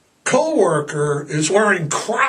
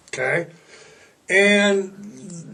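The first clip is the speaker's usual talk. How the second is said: ordinary delivery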